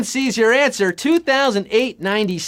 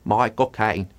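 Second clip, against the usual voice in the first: Michael Caine impression; 11 semitones lower, 4 dB quieter than usual. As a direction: drop the voice deep and do the movie-star Londoner. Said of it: "This is how Michael Caine talks".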